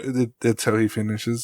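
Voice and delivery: normal talking voice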